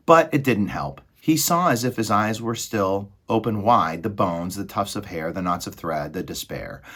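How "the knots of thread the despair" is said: ordinary delivery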